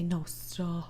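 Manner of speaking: soft unvoiced gossip whisper. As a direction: breathe it secretly. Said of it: "I would say".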